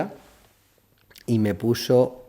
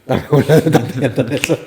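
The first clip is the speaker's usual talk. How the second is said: laughing; 4 semitones higher, 8 dB louder than usual. Retold or imitated. Laughing retelling the fan's order.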